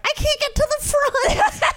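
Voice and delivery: put-on high, panicky little kid voice; freaking out kid. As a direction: squeak it like a panicking child. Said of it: "freaking out".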